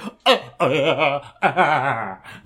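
freaked-out laughing squeal; mock shriek of laughter. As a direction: laugh like scared first years. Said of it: "first years are like".

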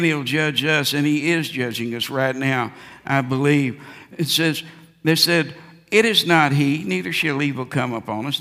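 ordinary talk, nothing marked

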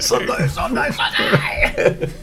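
high shouted announcer hype; monster truck ad. Pretend. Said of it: "it's like those monster truck ads".

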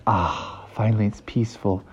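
low, soft relief; relieved sigh. Acted. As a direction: sink into hushed contentment. Characters relieved at the quiet.

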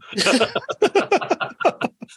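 laughing hard, pitch jumping up; real laugh at his own story; high burst of laughter. Real, not acted. Interviewee laughs at Muldoon's punchline, high and helpless.

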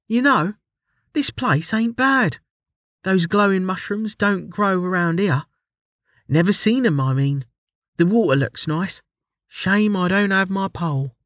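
relaxed admission, musing aloud; Ruli's voice. A narrator's reading.

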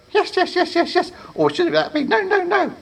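very high, nervous squeak; squeaky creature. Acted. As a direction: babble like a frightened little goblin. Nervous apprentice answers.

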